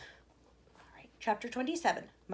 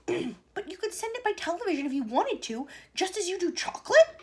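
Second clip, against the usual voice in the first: eager question; 9 semitones higher, 6 dB louder than usual. A narrator's reading. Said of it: "asked Mike T V".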